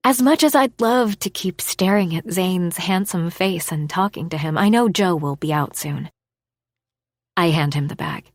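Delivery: ordinary delivery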